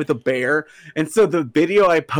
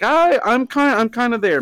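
giddy high voice; 10 semitones higher, 5 dB louder than usual. Retold or imitated. Admitting to near insanity, laughing.

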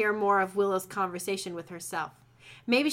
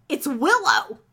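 loud, higher burst of shock; alarmed exclamation; 5 semitones higher, 8 dB louder than usual. Acted. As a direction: blurt out a startled realisation. Mark's alarmed realisation in story.

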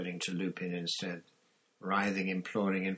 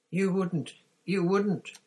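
high, louder terrified gasp; 12 semitones higher, 8 dB louder, slower than usual. Acted. Pettigrew gasps as they close in, much higher and terrified. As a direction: gasp it out in panic. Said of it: "gasped Pettigrew".

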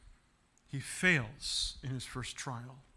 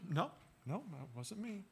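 much softer hushed denial; child denying; 2 semitones higher, 11 dB quieter than usual. Retold or imitated. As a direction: trail off into a small guilty no. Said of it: "so easy to say no".

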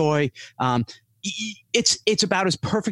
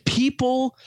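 exasperated; 10 semitones higher than usual. Retold or imitated. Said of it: "I'm like".

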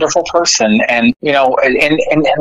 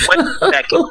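mock double take; 11 semitones higher than usual. Retold reaction in bar.

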